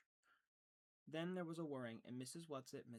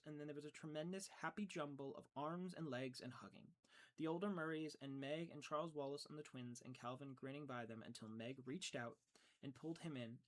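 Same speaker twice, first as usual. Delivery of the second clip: ordinary delivery, nothing marked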